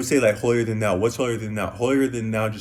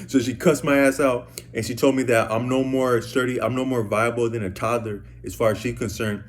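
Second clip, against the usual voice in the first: usual speaking voice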